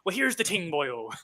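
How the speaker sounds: slow Irish brogue; unhurried Irish pirate accent. Shown as a demonstration. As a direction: launch into a drawn-out Irish pirate greeting. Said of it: "I'll launch into her dialogue".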